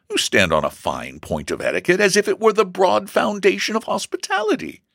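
high-pitched, animated mother's voice; chiding mother. A narrator's reading. Mother defends inviting her son's classmate, chiding.

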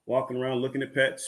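ordinary talk

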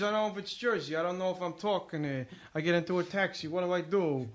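rambling New York or Jersey accent. Shown as a demonstration. Comic unsure it is Jersey, rambling New York accent. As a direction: keep riffing like a New Yorker. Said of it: "I do a New York accent".

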